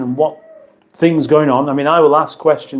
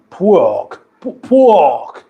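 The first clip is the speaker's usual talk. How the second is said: drawn-out Cockney "pork"; mock Cockney on the word "pork"; Cockney accent; 3 semitones higher, much slower than usual. Shown as a demonstration. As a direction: say "pork" like a Cockney, long and falling. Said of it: "Is it a Cockney thing?".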